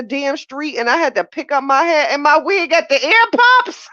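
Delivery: high, loud excited storytelling. Real, not acted. Telling viewers how her wig flew down the street, excited. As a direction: tell it high and loud, all worked up.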